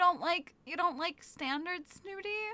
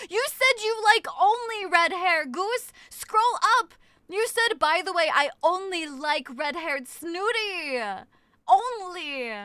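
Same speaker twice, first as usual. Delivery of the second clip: mock accusing shout; 4 semitones higher, 7 dB louder, slower than usual. Real, not acted. Accusing chat, quoting them.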